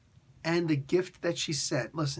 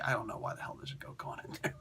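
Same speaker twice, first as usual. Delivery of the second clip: whisper; 3 semitones lower, 10 dB quieter, faster than usual. Real, not acted. Aside about the goat.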